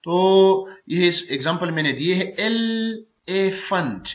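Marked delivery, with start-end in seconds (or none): 2.4-4.1 elephant drawn out very slowly, ए-लि-फेंट; syllable by syllable. Shown as a demonstration. Teacher demonstrating a three-syllable word, elephant slowly split in three. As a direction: break elephant into three slow beats. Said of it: "elephant three syllable word है".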